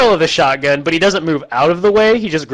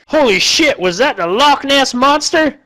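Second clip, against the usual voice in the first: dumb posse guy; 10 semitones higher than usual. Retold or imitated. Posse member gawking at the fallen giant, goofy high voice. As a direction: squeak it out like an amazed yokel.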